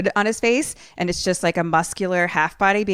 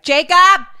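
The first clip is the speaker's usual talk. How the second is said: loud, high call across the ward; yelling a name; 12 semitones higher, 12 dB louder than usual. Retold or imitated. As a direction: yell his name to find him. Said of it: "he's just yelling for him".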